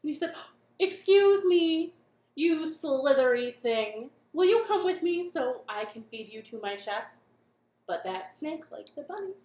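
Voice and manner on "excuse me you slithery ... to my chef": monster chef. Acted. Monster chef booming at the snake. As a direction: boom it out as a pushy monster cook.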